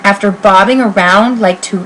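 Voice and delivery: ordinary delivery, nothing marked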